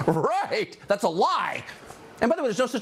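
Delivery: high, lilting mock agreement; mock sing-song. Host scoffs at Planned Parenthood's claim, mock sing-song. Mocking the pundit's "Right" again.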